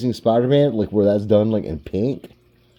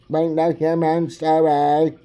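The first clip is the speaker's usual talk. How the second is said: high, loud mechanical robot voice; 9 semitones higher, 5 dB louder than usual. Shown as a demonstration. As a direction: announce it like a menacing robot. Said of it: "a better robot voice".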